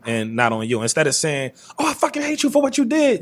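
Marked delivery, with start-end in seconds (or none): 1.8-3.2 a sudden burst of mock rage, pitched way up; hammed-up furious accusation; angry outburst. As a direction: fire off the accusation like you're losing it. Example of lashing out at someone.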